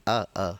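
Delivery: अ said short, one beat; short vowel demo. Shown as a demonstration. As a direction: clip the अ short. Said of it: "पहला अ है एक मात्रिक". (छोटा अ, एक मात्रा)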